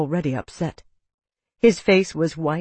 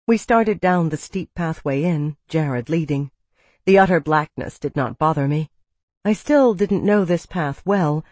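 plain reading voice

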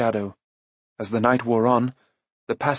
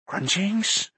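hopeful creature; 9 semitones higher than usual. A narrator's reading. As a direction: perk up at the thought of food. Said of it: "Gurgi brightened".

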